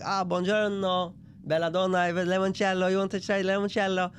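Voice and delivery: put-on charming Italian host accent. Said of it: "very Italian man".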